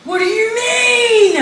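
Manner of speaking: high, drawn-out wail of disbelief; hammed-up distraught cry. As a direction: wail it slowly in dramatic despair. Acting out shock at the denial.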